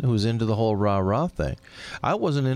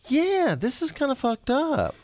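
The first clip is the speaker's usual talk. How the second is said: voicing the inner thought; 14 semitones higher, slower than usual. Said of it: "critically think through".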